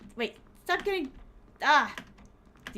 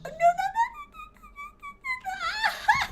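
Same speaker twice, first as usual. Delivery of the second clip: high terrified wail of no's; panicked screaming; 7 dB louder than usual. Real, not acted. Streamer shrieking in terror at the monster, high pitched wail.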